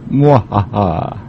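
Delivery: slow hammed-up villain cackle; evil laugh. Mock villain laugh after doom line.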